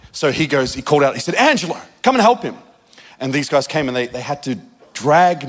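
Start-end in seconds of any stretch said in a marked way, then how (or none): 1.4-2.6 higher, fast call across the lake; a retold urgent shout, lightly done. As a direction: summon help quickly from afar. Mentor calls helper over.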